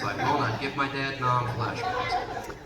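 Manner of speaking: usual speaking voice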